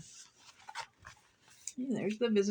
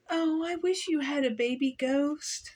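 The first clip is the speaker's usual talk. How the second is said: higher wistful lady voice; sadly; 6 semitones higher than usual. A narrator's reading. Lady visitor sadly wishes for a baby ghost. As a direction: wish it sadly. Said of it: "said the lady sadly".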